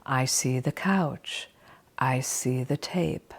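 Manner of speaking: slow, soft, gentle listing; slow gentle rhythm. Shown as a demonstration. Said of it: "you're going to do it like this".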